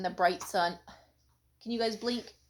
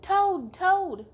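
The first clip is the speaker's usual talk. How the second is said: high, louder call of a name; crying out; 10 semitones higher, 7 dB louder than usual. A narrator's reading. Frog calling to sleepy Toad, loud cry. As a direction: call out eagerly through the door. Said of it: "cried Frog".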